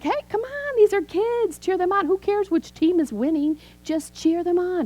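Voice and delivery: very high-pitched sideline rallying; rally the other spectators like an excitable grandma; high, eager cheerleading; loud cheering Nana. Retold or imitated. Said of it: "you're the loud Nana".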